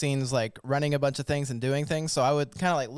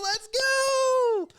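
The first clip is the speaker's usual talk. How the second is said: excited cheer. Real, not acted. Host cheers guest joining.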